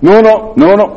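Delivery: high, loud teasing no; mocking Raj behind his back; cheeky imitation of the teacher. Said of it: "so I'm kind of".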